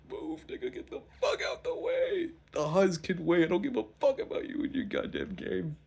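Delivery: put-on high, loud drunk yell; hammed-up shouting to clear the way; shouting at a passer-by to get out of the way; mock yell. Acted yelling at the Huz.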